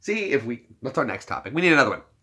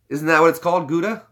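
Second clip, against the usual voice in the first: normal talking voice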